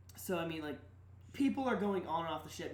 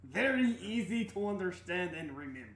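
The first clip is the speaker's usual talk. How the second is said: put-on Russian accent; 4 semitones higher, 3 dB louder than usual. NPC Vladimir continues.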